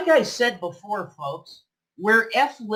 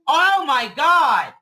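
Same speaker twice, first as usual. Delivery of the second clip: exasperated shout; 5 semitones higher, slower than usual. Real, not acted. Husband snaps, slow and loud.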